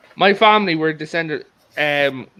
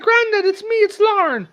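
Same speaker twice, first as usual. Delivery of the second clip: put-on much higher, pleading girl's voice; pleading granddaughter. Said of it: "It's like".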